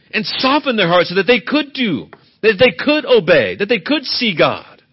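loud, rising preacher's cadence; preaching at full force. Acted. Preacher pressing the congregation on God's mercy, preaching hard. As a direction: drive every line home to the pews.

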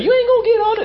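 very high-pitched incredulous dad; dad impression. Preacher replaying dad's treadmill retort for laughs, high-pitched disbelief. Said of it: "That's how my dad would talk".

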